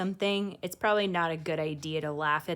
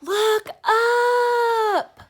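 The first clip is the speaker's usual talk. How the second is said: much higher, much louder call; excited shout; 11 dB louder than usual. A narrator's reading. Girl shouts to everyone as the meteor appears, excited shout. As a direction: yell it skyward to everyone.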